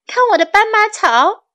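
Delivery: storybook shout. A narrator's reading. Child shows off zebra grass to class, high shout. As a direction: shout it out, pitched well up. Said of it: "他们喊了起来".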